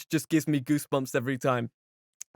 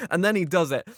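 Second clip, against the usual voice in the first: usual speaking voice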